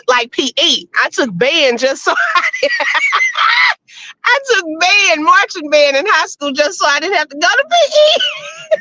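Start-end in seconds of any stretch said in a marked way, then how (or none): 2.8-3.7 high laugh. Real, not acted. Laughs about band and P E. 4.2-8.8 higher, laughing through the words. Real, not acted. Laughs while explaining.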